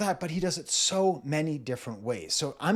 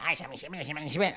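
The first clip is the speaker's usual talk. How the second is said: lightly voiced rapping puppet; a hint of a little vampire dummy rapping; ventriloquist's doll; 3 dB quieter, much faster than usual. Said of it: "a little vampire doll on his lap".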